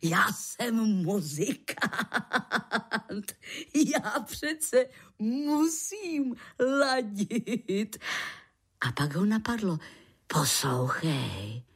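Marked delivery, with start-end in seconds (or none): 0.0-8.0 drawn-out words in mock protest; amused protest. Musician tells lichožrout he must match, amused protest. Man protests after roaring with laughter. 10.3-11.6 low-pitched man's questioning voice; puzzled man. Acted. Man asks lichožrout what he will live on, puzzled low voice. Voices Vavřinec asking Hyhlík.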